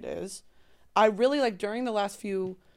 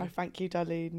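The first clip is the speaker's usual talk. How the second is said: put-on British, softer and a touch lower; British accent; 3 semitones lower, 6 dB quieter than usual. Friend praised for British accent replies, in British accent. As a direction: play a gracious British lady. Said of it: "when you do your British accent".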